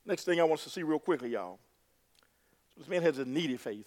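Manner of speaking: ordinary delivery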